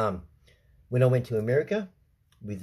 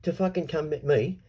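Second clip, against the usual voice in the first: angry retold challenge; 6 semitones higher than usual. Confronting the man about his authority, angry. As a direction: square up to him with the question. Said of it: "And I said".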